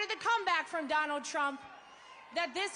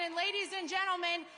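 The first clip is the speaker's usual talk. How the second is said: ordinary talk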